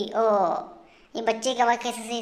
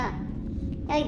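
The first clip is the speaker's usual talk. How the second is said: whispered; 6 semitones higher, 5 dB quieter, much slower than usual. Real, not acted. Scared hush while baby watches.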